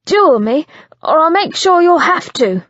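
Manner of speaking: loud, high ultimatum. Acted. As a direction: force the final choice. Final ultimatum.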